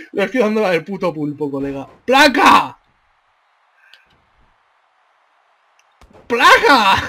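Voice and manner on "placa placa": higher, louder impact cry; shouted hit. Real, not acted. Gamer celebrating each hit on the opponent, shouted hit. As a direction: shout it as the blow lands. Voicing hits in the game.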